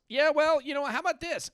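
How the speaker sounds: high, loud mock comeback; defiant husband. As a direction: argue back at a nagging wife. Acted argument with wife over chores.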